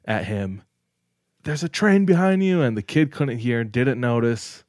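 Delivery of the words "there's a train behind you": retold yell. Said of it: "So he was yelling".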